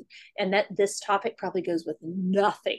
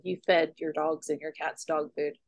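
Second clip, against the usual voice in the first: ordinary talk, nothing marked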